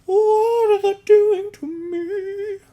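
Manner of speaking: hammed-up drunken wail; high wail. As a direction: stretch it out into a strained howl. Drunk self mock-panicking over tequila.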